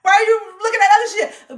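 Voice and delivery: high, loud defensive comeback; mock defensive retort. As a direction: fire it back defensively. Mock defensive snap back.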